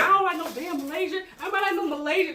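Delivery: high, loud mock protest; retold getting mad. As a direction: act out his heated denial. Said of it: "he's getting mad".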